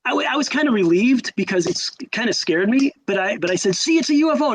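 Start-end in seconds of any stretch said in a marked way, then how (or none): 3.8-4.5 high, triumphant mock protest; retold insistence. Teen insisting to his friends, retold excited insistence. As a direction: imitate a kid proving his point, pitched way up.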